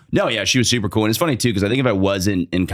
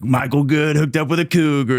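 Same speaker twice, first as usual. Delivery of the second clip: bro voice; 8 semitones higher than usual. Retold or imitated. Fraternity bros quoted.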